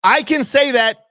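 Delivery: high, loud, playful insistence; gleefully claiming the right to say it; excited claim. Real, not acted. Excited claim after joke.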